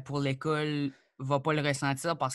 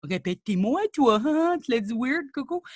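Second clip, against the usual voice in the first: high, louder mimicking taunt; mocking gawker; 9 semitones higher, 3 dB louder than usual. Someone making her repeat her words, mocking. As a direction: imitate someone gawking at your accent, high and goading. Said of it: "quand quelqu'un te rép-, répète tes mots".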